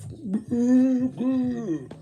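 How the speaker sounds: words stretched into a huge yawn. Real, not acted. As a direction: talk through a big lion yawn. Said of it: "lion yawning out here".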